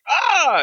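sudden high yelp imitating a shock sting; a quick shrieked "Ah" aping horror music; mock jump scare shriek. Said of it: "in your face for a second".

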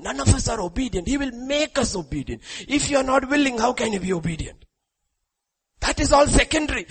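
high, loud and pressing; preaching at full force. Real, not acted. Preacher urging the congregation, high and loud. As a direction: hammer it home to the crowd.

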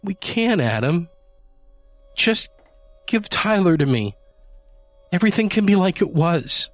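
high, pleading, voice straining. A narrator's reading. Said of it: "she pleaded with open arms".

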